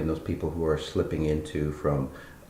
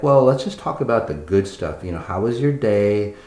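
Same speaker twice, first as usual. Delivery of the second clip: louder, higher mocking voice, breezy and dismissive; mock cheerful avoidance; 4 semitones higher, 7 dB louder than usual. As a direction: act like nothing is wrong, loudly. Mocking a head-in-sand attitude, louder.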